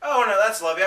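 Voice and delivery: usual speaking voice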